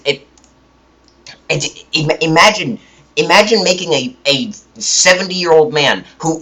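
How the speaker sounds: ordinary talk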